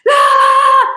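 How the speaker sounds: sudden high, loud yell; frustrated yell. Retold or imitated. Mom swamped by flying stuff at home, sudden loud yell. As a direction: let out one sharp exasperated yell. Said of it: "'cause I'm like".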